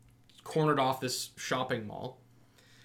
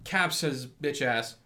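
ordinary talk, nothing marked

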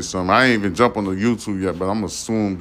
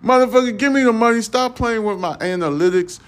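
much higher, a hammed-up indignant tirade at the platform; comically furious complaint, pitched far above normal; mock outraged rant; 10 semitones higher than usual. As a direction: demand your money back like you've been robbed, voice way up high. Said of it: "I'm like".

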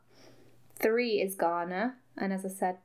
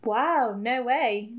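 louder mock amazement; ironic; 3 semitones higher, 6 dB louder, much slower than usual. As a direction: feign astonishment with obvious irony. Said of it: "no irony in my voice".

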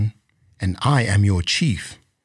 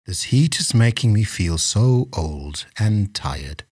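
put-on weary old chief grumbling sadly in a lower voice; 4 semitones lower than usual. A narrator's reading. Old chief alone with his thoughts in the heat, sad. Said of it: "he thought sadly to himself".

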